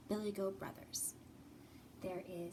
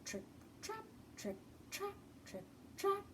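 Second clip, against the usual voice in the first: little goat footsteps; 10 semitones higher, 9 dB quieter than usual. Acted. Little goat crossing bridge.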